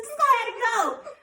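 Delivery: high-pitched, sing-out reassurance; imitated nurse soothing a patient; nurse coaxing.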